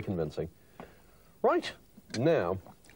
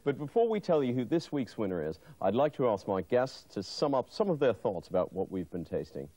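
TV presenter; 3 semitones higher than usual. Acted. Host sums up on air.

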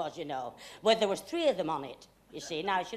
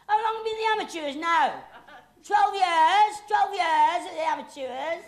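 high, loud and brisk questions; loud quiz; 8 semitones higher, 7 dB louder than usual. Acted. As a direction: draw her out for the audience.

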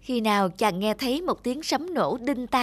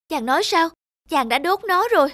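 high, stunned questions; a wife aghast, much higher; shocked disbelief; 6 semitones higher, 3 dB louder than usual. Acted. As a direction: she can't believe what he did. Wife learns frog skin burned.

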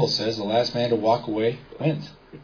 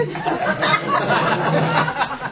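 a real, airy laugh high up; laughing at his own story; high breathy laugh; 12 semitones higher than usual. Real, not acted. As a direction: let a delighted laugh escape. Laughs after the punchline.

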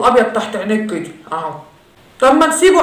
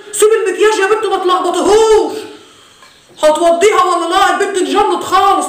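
shouting father and pleading daughter; 7 semitones higher than usual. Acted.

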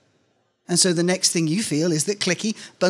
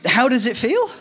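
incredulous echo; 7 semitones higher, 4 dB louder than usual. Acted. Narrator echoes interviewer question.

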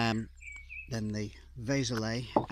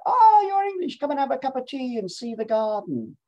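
delighted invitation; 11 semitones higher, 6 dB louder than usual. Retold or imitated. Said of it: "two women who spoke very good English and said".

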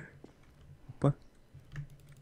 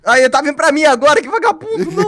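scared horror game streamer; 11 semitones higher, 6 dB louder than usual. Real, not acted. Enemy chasing him now.

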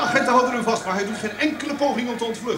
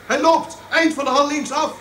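louder, higher, hurried reporter call; urgent live report; 6 semitones higher, 4 dB louder than usual. Acted. Reporter on the air, calling it out as the killer is led off. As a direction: press on as he vanishes from sight.

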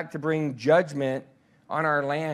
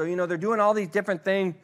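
usual speaking voice

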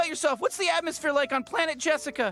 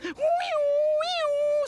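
high, slow drawn-out squeals of glee; gleeful wee; 10 semitones higher, much slower than usual. Acted. Man acting out the spa ride, squealing high with glee. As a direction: squeal like riding a slide.

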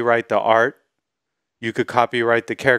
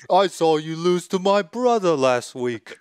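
Cockney orc voice; 6 semitones higher than usual. Pretend. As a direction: play a rowdy Cockney orc. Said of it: "like Cockney sounding orcs".

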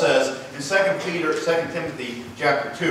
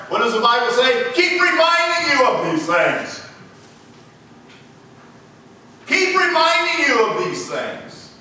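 raised, high-pitched pulpit cry; full-force preaching; 10 semitones higher, 7 dB louder, slower than usual. Acted. Preacher declaring the devil lost, full-force preaching. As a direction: proclaim victory to the congregation, drawn out.

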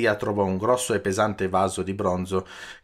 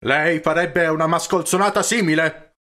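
loud, raised-pitch outraged question; indignant shout; 7 semitones higher, 6 dB louder than usual. A narrator's reading. Peppone confronting Don Camillo over the photographs, indignant shout. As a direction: yell it as a shocked accusation. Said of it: "urlò Peppone".

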